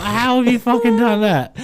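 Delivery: high, loud and laughing; laughing disbelief. Real, not acted. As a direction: blurt it out, barely holding back laughter. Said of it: "I was pissing myself laughing".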